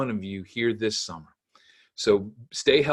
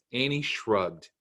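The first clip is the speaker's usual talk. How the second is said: usual speaking voice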